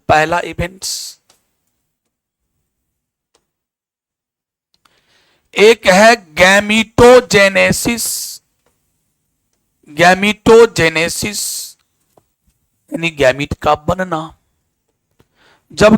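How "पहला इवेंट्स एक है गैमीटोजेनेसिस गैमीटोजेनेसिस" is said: drawn-out syllables for note-takers; slow dictation. Real, not acted. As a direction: dictate the term slowly, syllable by syllable. तो इसमें नाम लिखिए. (शब्द खींचकर धीरे लिखवाना)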